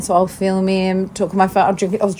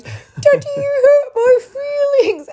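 crying child imitation. Imitating her son telling mum, high tearful toddler voice. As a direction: whimper it like an upset small child. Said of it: "he came in and he goes".

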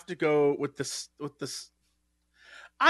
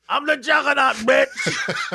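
higher, gravel-free shouted quote with a laugh; DeVito Juggernaut; 7 semitones higher, slower than usual. Retold or imitated. Imitating DeVito as Juggernaut, laughing shout. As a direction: bellow it like Danny DeVito's Juggernaut.

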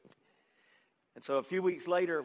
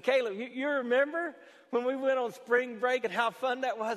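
put-on eager, higher-pitched leading question to a child; upbeat dad; 7 semitones higher, 4 dB louder than usual. Father reminding his son of spring break, upbeat and leading. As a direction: talk up a happy memory, voice lifted.

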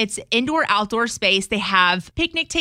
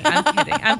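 raised, teasing insistence; playful louder repeat; 4 semitones higher, 4 dB louder than usual. Acted. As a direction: say it again, louder and brighter.

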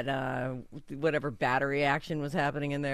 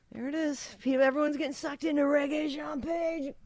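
high-pitched mock announcement; teasing announcement; 12 semitones higher than usual. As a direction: tease in a high, lilting call. Retold teasing remark to partner.

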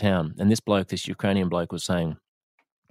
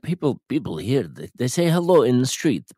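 put-on light Ukrainian accent in broken English, a little higher; Ukrainian bloke's accent; 4 semitones higher than usual. Ukrainian man telling the host about friendly strangers, in a light Ukrainian accent. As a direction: imitate the Ukrainian man's amazed, halting English. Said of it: "He said".

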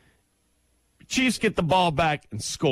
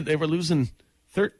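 ordinary talk, nothing marked

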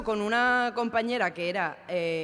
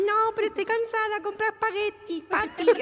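put-on high little-girl baby talk; sweet toddler voice to her husband; 11 semitones higher, 3 dB louder than usual. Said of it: "hables como una niña de tres años".

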